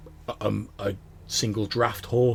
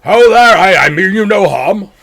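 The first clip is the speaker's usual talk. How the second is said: hearty dwarf; 10 semitones higher, 18 dB louder than usual. Acted. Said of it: "and he's like".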